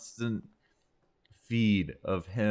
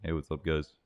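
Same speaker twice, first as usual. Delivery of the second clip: low, softer deadpan monotone greeting; 7 semitones lower, 8 dB quieter than usual. Retold or imitated. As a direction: say it totally flat and lifeless. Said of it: "kinda did a monotone voice".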